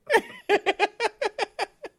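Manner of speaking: long high laughter; real laugh at the myth. Real, not acted. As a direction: laugh it off, amused. Laughing at the superstition.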